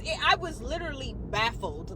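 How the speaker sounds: normal talking voice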